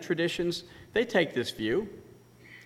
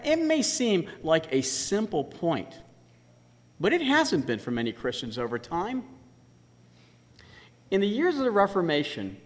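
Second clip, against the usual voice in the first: ordinary delivery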